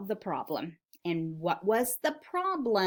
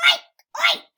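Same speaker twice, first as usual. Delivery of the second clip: very high, loud oinks; loud pig squeal; 9 dB louder than usual. Acted. Teacher reads the noisy pig to children, high loud oinking. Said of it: "went the pig".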